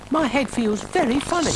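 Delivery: high, alarmed snail pipe; worried little snail. Acted. Brian the snail distrusts Dougal's experiment, high worried voice. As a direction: protest in a small high voice.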